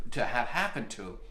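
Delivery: ordinary delivery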